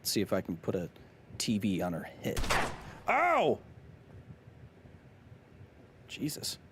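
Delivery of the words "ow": high-pitched "ow"; sudden sharp cry of ow; startled yelp. Real, not acted. Streamer reacting mid-game, startled yelp.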